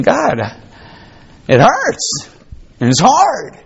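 high, pleading cry to God; anguished prayer. Pretend. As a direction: pour out the pain in prayer. Voicing Baruch praying.

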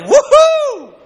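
joyful whoop. Real, not acted. Preacher cheering about going home to heaven early, joyful whoop. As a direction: cheer like you just won.

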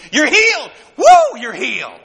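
exultant proclaiming. Acted. Preacher to congregation, joyful exultant cry. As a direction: lift it up like a shout of praise.

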